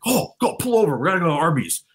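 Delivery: high mock voice, begging to pull over; lightly hammed-up bandmate voice; imitating Johnson. Friend imitating Johnson craving Arby's, high mock voice. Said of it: "of Johnson going like".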